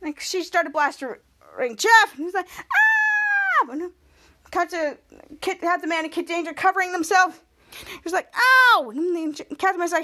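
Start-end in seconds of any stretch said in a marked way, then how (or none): 2.6-3.8 long, high held mock scream; retold yell of someone being blasted; sustained high-pitched "ah". Jeff reacts to being blasted. 8.3-8.9 loud, high "ow"; retold cry of someone getting hit; pitched-up play yelp; mock pained yelp. Character hit while covering up.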